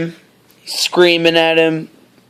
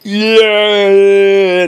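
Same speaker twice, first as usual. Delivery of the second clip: triumphant yell; 5 semitones higher, 4 dB louder than usual. Retold or imitated. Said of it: "he's just like".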